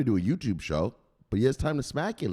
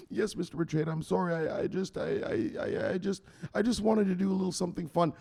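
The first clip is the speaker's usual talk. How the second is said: high, softer, stammering apology; cowed stammer; 7 semitones higher, 3 dB quieter than usual. Acted. Victim apologizing to the enforcer, high stammer. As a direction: trip over the words apologizing.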